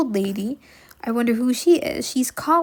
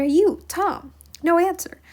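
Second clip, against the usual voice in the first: put-on high, louder call for a missing boy; 7 semitones higher, 5 dB louder, slower than usual. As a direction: call the boy across the house, higher and louder. Aunt calling for Tom.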